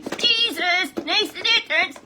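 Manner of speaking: high, loud, slow puppet voice; cheerful dummy joins the lesson. Acted. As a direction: let the puppet proclaim it slowly.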